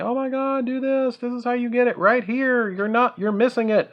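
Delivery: high-pitched mock yelling of frantic viewers; mock screaming. Viewers yelling advice at the player, mock screaming. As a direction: imitate exasperated onlookers in a high, strained voice. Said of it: "you are probably screaming".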